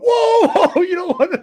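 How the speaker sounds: high, laughing alarmed warning; jumping up to stop the baby hand-off, alarmed; alarmed whoa. Retold or imitated. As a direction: leap in to stop it, half laughing. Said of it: "jumped up at the same time going".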